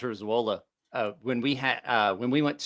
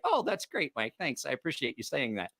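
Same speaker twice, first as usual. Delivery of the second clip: imitating polite thanks; 9 dB quieter than usual. Imitating Bob's modest thanks.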